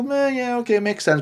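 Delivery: high, shrugging meh; lightly mock a grudging okay; half-hearted agreement.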